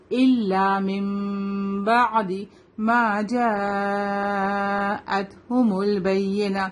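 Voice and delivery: جاءتهم with the "jaa" held very long; slow chant, stretching the vowel in جاءتهم; long madd. Shown as a demonstration. Teacher shows a student the five-to-six count, long madd. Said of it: "Five to six count اس کو لمبا کریں گے".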